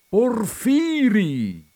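loud, high, drawn-out cry of recognition; esclamazione gioiosa. Acted. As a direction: call out the name in astonished delight. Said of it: "esclamò il grasso vedendo il magro". (grido di gioiosa sorpresa, acuto e prolungato)

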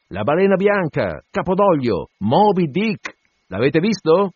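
high, emphatic repeated call. A narrator's reading. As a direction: spell it out high and carrying. Repeating the question across to the ship.

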